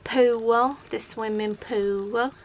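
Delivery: imitating a child's Southern drawl; strong Southern accent. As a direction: mimic a little Southern girl, slowly. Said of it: "a very strong Southern accent".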